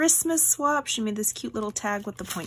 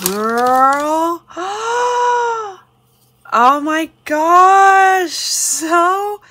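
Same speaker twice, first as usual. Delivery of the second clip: high, loud gasping squeal of delight; excited; 9 semitones higher, 10 dB louder, much slower than usual. Real, not acted. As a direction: stretch it out, overwhelmed by a gift. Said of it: "So I'm excited".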